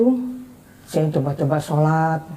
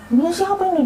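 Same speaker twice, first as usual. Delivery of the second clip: retold angry yelling; 12 semitones higher, faster than usual. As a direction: imitate a mother demanding an answer, pitch way up. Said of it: "dia teriak-teriak". (menirukan ibu berteriak marah, nada tinggi)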